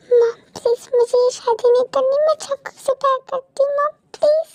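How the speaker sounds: crying daughter's plea, pitch rising; tearful pleading. Acted. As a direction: beg mother through tears. Daughter begs mother to cancel wedding.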